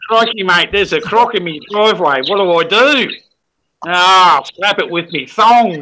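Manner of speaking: full-throttle Strine, hammed-up crocodile-hunter excitement; Steve Irwin. Shown as a demonstration. Showing co-hosts a croc emergency in Strine, exaggerated Australian accent. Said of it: "I can be a fucking Steve Irwin".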